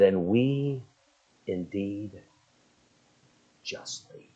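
fading from low murmur to a bare whisper; hushed confession. Retold or imitated. The thief confessing his guilt on the cross, fading to a whisper. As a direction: let it sink to a breathless whisper of guilt.

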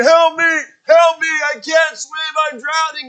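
high loud shout for help; drowning swimmer screaming for help; panicked cry for help. Retold or imitated. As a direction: yell like you're drowning. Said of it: "flailing your arms around saying".

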